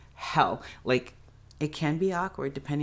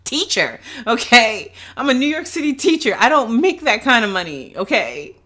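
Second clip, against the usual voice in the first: mock defensive teacher; 9 semitones higher, 8 dB louder than usual. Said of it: "Be like".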